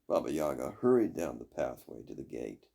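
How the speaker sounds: ordinary delivery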